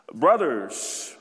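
ordinary delivery

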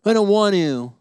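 loud, whiny mock refusal; defiant kid. Pastor voicing a child refusing parents, loud and defiant. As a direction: dig in like a stubborn teen. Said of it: "you're like".

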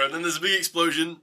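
normal talking voice

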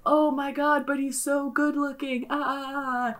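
soft, high, drawn-out lament; mock-tormented little wail; comic whining. Torn over good-looking bad man.

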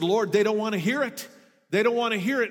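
pleading protest. Retold or imitated.

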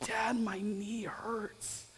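put-on drawn-out, whispered little-boy complaint; slow, hushed imitation of a hurting kid; hurt child. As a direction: imitate a small son whimpering softly about pain. Voicing his hurt young son.

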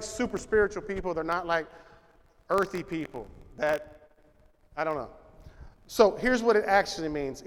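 ordinary delivery

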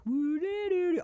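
high, floaty sung-out warble; wordless woozy high trill; imitated floaty audio. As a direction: mimic trippy background sound with your voice. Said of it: "all the floaty stuff".

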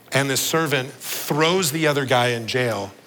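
ordinary talk, nothing marked

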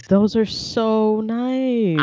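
slow, stretched-out admiration; drawn-out admiring. Real, not acted. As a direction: linger on every word.

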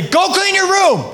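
high, slow, put-on nagging parent voice; bossy parent. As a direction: order the kid like a fed-up mom. Parent ordering child, own example.

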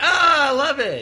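high, slow, loud swooning voice; drawn-out mock rapture; mock delighted fan. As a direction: gush it out like a fan won over. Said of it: "Then they're like".

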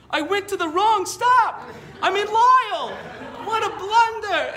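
put-on high, loud, slow panicked yelling in a Chicago accent; screaming Chicago accent. Old man screams into the phone in a Chicago accent. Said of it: "he's just screaming into my face".

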